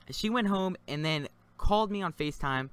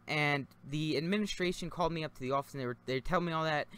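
ordinary delivery

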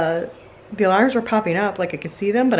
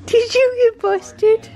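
much higher, slow sing-song squeal; squeaky pet talk; 4 dB louder, much slower than usual. Real, not acted. Owner squealing at busted dog, high slow sing-song.

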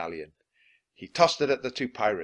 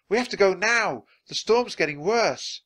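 high, loud insistence; urgent warning; 9 semitones higher, 6 dB louder than usual. A narrator's reading. Annie warns Jack of the storm, urgent and high. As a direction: press hard to leave at once.

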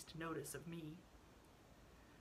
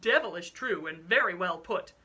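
booming call from the doorway; a loud hearty cry across the boathouse; 12 dB louder than usual. Acted. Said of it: "cried a voice at the entrance".

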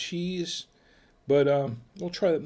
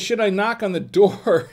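reading the comment through real laughter, slowly; can't keep a straight face reading it; laughing while reading; 6 semitones higher, 6 dB louder than usual. Real, not acted. Reading a funny comment aloud.

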